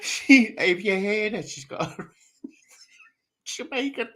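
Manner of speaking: breathless laughing whisper; barely getting it out through laughter. Real, not acted. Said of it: "laughs whisper".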